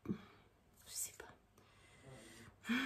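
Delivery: slow, breathy whisper trailing away; whispered to herself. Real, not acted. Unsure about the thick ink, slow whisper to herself. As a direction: let it fade into a whisper of doubt.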